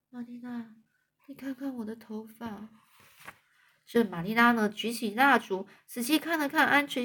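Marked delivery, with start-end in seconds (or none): 0.1-2.7 barely audible, much softer; almost no voice, ashamed; tiny mosquito voice. Acted. Anne shows Marilla her hair, very softly. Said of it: "用蚊子似的声音说".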